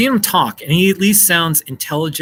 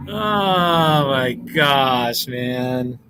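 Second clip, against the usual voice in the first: drawn-out sigh; much slower than usual. Real, not acted. Sighs recalling news day.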